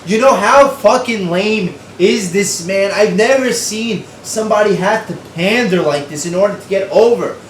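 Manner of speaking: ordinary talk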